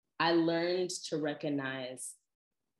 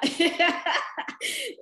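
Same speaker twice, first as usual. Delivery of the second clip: loud high laugh; squealing laugh; high laugh burst; 8 dB louder than usual. Real, not acted. Laughs at own joke.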